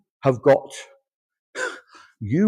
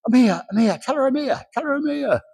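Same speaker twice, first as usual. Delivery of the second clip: high, bouncing "I'm here, I'm here"; pitched way up like an eager visitor jumping; eager excited spirit; 13 semitones higher than usual. Retold or imitated. Spirit jumping behind the sitter, announcing herself, high and excited.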